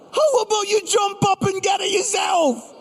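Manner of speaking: high, stunned repeat of the cheeky reply; incredulous repeat. Acted. Repeating son's cheeky line incredulously.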